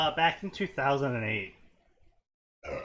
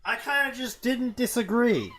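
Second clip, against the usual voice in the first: coy and innocent, pitched up; coy high voice; 9 semitones higher, 6 dB louder than usual. Pretend. Coy denial about fooling a server.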